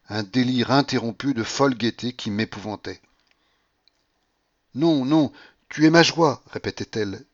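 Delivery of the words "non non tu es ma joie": loud, high, rapturous declaration; impassioned, pitched-up outburst; feverish joy. A narrator's reading. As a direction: exclaim love in delirium. Delirious Paulina declares love.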